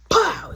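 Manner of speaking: loud, high "pow"; sharp burst mimicking cards hitting a table; imitated card slam.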